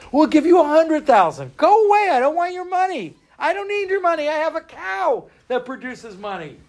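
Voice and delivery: high, indignant refusal; a feisty cow owner. Acted. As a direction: brush them off, offended and proud.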